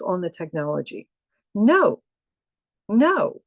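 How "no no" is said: emphatic no. Real, not acted. Emphatic refusal then laugh.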